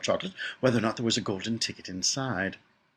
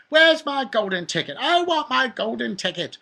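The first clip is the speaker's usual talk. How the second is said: high, loud bratty demand; imitated tantrum scream, pitched way up; screaming spoilt girl; 13 semitones higher, 7 dB louder than usual. A narrator's reading. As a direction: shriek it like a child having a tantrum. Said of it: "she would scream at me".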